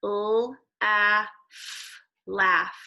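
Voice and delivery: sounds said apart then laugh; teacher sounding out laugh. Shown as a demonstration. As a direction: segment L, a, f then blend laugh. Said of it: "go to the beginning of the word".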